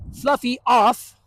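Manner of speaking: slow, drawn-out command to the dog; firm dog command. Retold or imitated. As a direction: order the dog off the couch. Owner commanding dog off couch.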